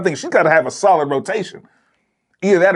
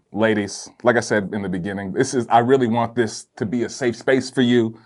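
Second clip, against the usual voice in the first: ordinary delivery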